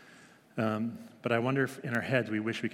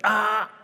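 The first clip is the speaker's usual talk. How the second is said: loud, high sharp "ah" of protest; toddler protest; 11 semitones higher, 9 dB louder than usual. Retold or imitated. Toddler interrupting a grown-up topic, loud high protest. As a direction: shut the grown-ups up like a toddler. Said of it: "she'll just go".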